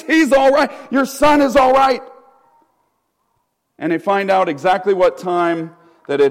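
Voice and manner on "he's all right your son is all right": excited news. Retold or imitated. Servants shouting good news.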